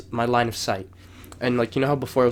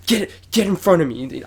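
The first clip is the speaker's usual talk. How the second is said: imitating a frantic order, higher and louder; high-strung nagging; 6 semitones higher, 6 dB louder than usual. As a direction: act out being worked up and bossy. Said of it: "I was so high-strung about".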